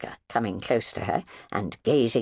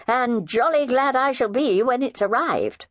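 nonchalant; 14 semitones higher, 4 dB louder than usual. Acted.

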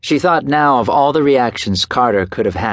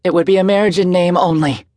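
hasty; 8 semitones higher than usual. A narrator's reading. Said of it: "she added hastily".

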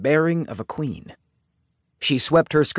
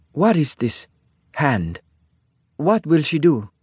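put-on puzzled, Italian-accented questioning; heavy Italian accent; 3 semitones higher than usual. A narrator's reading. Isabella asks what having a hand means, Italian accent. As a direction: question the English phrase in a thick Italian accent. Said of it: "Isabella asked in her heavy Italian accent".

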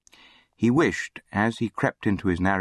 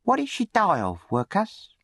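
high, needling bully's voice; taunting charity boy; 13 semitones higher than usual. A narrator's reading. Charity boy aggravates the orphan at dinner, high taunting.

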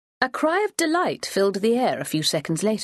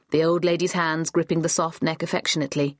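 ordinary delivery

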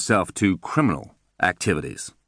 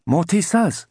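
much higher, bobbing servile waiter; eager waiter voice; 12 semitones higher than usual. Acted. Disguised contact playing the waiter, fawning high voice.

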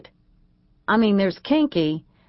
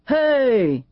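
calling out; 7 semitones higher, 5 dB louder than usual. A narrator's reading. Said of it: "he called after me".

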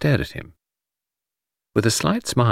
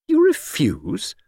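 slow, high incredulous question; astonished echo; 7 semitones higher, much slower than usual. Acted. Friend hears detective turn down the case, astonished. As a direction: repeat it in slow disbelief.

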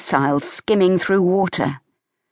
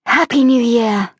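put-on higher and louder young woman, bright New Year cheer; Evie's cheerful greeting; 7 semitones higher, 4 dB louder than usual. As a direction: Evie calling it out gaily. Evie greets her father.

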